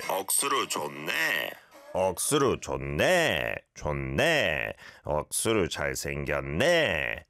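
네 swept sharply up, then falling; Busan intonation. Shown as a demonstration. Host showing listeners the Busan dialect, rising swoop on 네. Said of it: "this is how you're supposed to say it".

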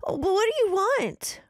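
high, loud, stammering plea; terrified stammer. Acted. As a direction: stutter the question in terror.

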